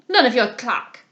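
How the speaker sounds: loud angry snap at his wife; angry cry. A narrator's reading. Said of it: "cried he angrily".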